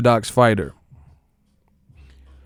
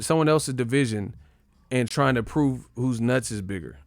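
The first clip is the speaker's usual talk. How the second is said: ordinary talk, nothing marked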